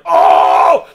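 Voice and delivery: imitated roar of a whole room; big, loud communal "ohhh"; crowd's shocked "oh". Comic imitates the club reacting to a faux pas, loud shocked roar. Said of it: "the fucking place just goes like".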